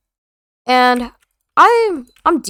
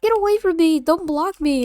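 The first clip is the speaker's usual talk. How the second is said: put-on higher, whiny kid pushing him off; mocking kid voice; 5 semitones higher than usual. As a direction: play the bratty kid shoving you away. Kid pushing speaker away in game.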